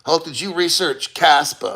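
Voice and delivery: ordinary talk, nothing marked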